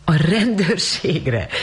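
slow, high incredulous echo; amused disbelief. A narrator's reading. Rosemary repeats the word, amused and incredulous. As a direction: laugh off the absurd idea. Rosemary laughs off police idea.

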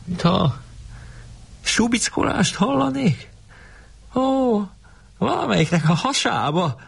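high, put-on voice with pauses; acted mock-serious night-time quip; deadpan joker. A narrator's reading. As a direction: tease in a high voice, pretending seriousness. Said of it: "aki nagyon tréfás tudott lenni, pedig rém komoly ember".

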